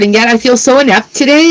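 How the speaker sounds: normal talking voice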